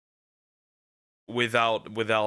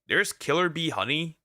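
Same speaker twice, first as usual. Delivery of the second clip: ordinary talk, nothing marked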